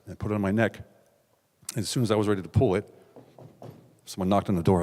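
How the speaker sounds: ordinary talk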